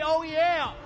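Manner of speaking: high, loud crowd-pumping shout; hype shout. Shown as a demonstration. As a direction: belt it out to lead the crowd. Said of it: "One more time, say".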